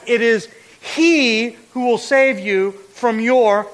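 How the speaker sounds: "he" held long and set apart; stretched, punched "he"; emphatic HE. Shown as a demonstration. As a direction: lean hard on "he", pausing round it. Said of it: "This is emphatic".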